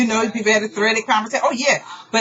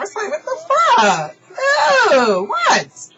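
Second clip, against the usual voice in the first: exasperated laugh; 4 dB louder, slower than usual. Real, not acted. Exasperated outburst at being mocked.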